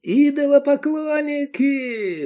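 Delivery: very high, drawn-out yell; wailing yell. A narrator's reading. Zealot screams at idolaters, spinning with his sword, yelling. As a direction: yell the accusation, stretched and strained. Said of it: "вопил Тураб".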